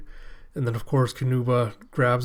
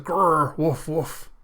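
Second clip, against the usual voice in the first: dog growl and bark; 4 semitones higher, much slower than usual. Retold or imitated. Imitating a dog.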